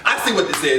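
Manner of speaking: low, knowing, catching them out; knowing suspicion. Retold or imitated. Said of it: "She was like".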